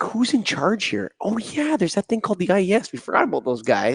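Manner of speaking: high-pitched mimicry of people in the industry; mock puzzled bystander. Voicing an industry that forgot the society, mock puzzlement.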